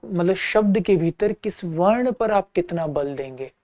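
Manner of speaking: ordinary talk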